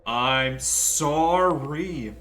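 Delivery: slow, loud, hammed-up sorry; exaggerated apology. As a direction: stretch the sorry out mockingly. Sarcastic drawn-out apology to ghost.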